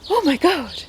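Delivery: put-on high shocked old lady exclamation; mock the startled lady; retelling a shocked stranger, high mock gasp; imitating old lady. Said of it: "There was an old lady goes".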